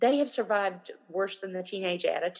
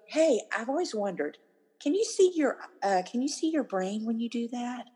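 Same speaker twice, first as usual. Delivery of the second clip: puzzled curiosity, pitched up; innocent questioning; 4 semitones higher than usual. Shown as a demonstration. Parent answering a teen's eye roll, innocently questioning. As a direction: wonder aloud, as if honestly confused. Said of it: "like a questioning look".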